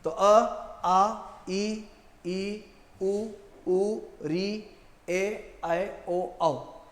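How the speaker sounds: each vowel clearly sounded, short ones clipped and long ones held; vowel recitation. Shown as a demonstration. Teacher drilling students on the eleven vowels. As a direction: run through the vowels like a drill. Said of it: "संधि का उच्चारण आपको सही से आना चाहिए".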